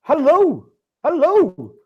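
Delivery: high, drawn-out sing-song hello; a bright, booming show opener; big hello. Real, not acted. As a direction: call out a cheery showman's greeting. Host's opening sing-song greeting.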